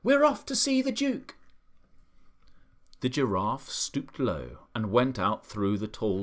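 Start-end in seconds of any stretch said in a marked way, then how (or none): 0.0-1.4 high, loud squeal of a monkey; monkey's excited cry. A narrator's reading. Monkey cries out to friends setting off, excited. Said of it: "cried the monkey".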